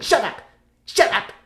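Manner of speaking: high, sudden "shut up"; comic annoyed "shut up". Acted. Actor to annoying sidekick, high comic annoyance. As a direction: snap "shut up" as a stage bit. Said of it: "I went".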